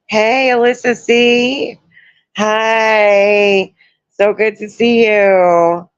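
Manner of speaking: high, bright hello to a viewer by name; happy to see a regular show up; cheery greeting. Real, not acted.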